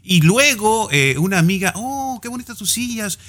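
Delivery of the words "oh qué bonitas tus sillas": softer, higher voiced friends chatting; quietly acting out the friends' exchange; acted dialogue. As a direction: play both friends lightly, hushed.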